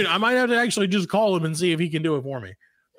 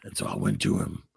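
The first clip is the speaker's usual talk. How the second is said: Steven Seagal impression; 7 semitones lower than usual. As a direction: mumble low like Seagal. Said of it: "And he's like".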